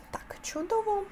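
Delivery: whispered aside. Real, not acted.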